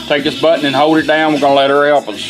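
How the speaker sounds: ordinary talk, nothing marked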